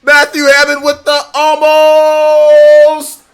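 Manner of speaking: high, loud sung-out donation call; hype shoutout. Real, not acted. Streamer hyping a big donation, loud and high singsong. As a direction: belt it out like a ring announcer.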